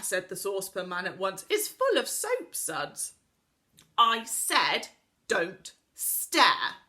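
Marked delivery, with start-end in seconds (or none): 1.5-3.1 much higher, slow and cheerful, mishearing; daft old Saucepan Man. Acted. Saucepan Man's deaf reply. 4.0-6.8 put-on loud exasperated girl, repeating herself; crying out. A narrator's reading. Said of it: "cried Connie".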